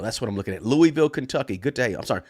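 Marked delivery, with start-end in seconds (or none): 0.6-1.1 Louisville said LOO-ee-vill; outsider's pronunciation. Real, not acted. Host welcomes Louisville, Kentucky, Louisville said LOO-ee-vill. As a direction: pronounce Louisville in three syllables, LOO-ee-vill. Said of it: "They don't say Louisville".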